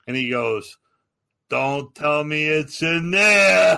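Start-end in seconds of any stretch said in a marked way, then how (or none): 1.5-3.8 very slow, drawn-out threat; slow-burning disbelief, retold; mock incredulous warning. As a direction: stretch each word out, getting louder.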